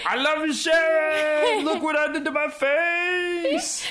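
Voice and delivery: high, drawn-out yell of affection; mock shout at a sleeping friend; mock drunken shouting. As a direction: holler it slow and way up high, hammed up. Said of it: "and be like".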